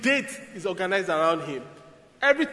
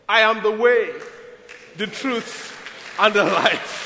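proclaiming; 4 semitones higher, 7 dB louder than usual. Acted. Preacher booms Jesus's words.

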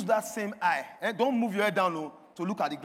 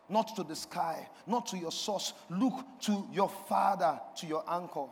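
ordinary talk, nothing marked

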